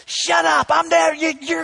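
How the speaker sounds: high, loud furious dad shout; yelling. Retold or imitated. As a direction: yell at the kids at the top of your lungs. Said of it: "I'm just yelling".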